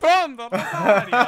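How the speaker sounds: high, loud southern Italian caller's bark; mock southern Italian caller. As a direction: announce it like the insistent southern Italian lady.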